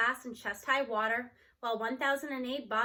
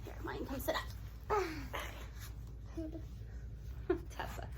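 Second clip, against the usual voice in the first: laughing whisper; 10 dB quieter than usual. Real, not acted. Laughing aside to child.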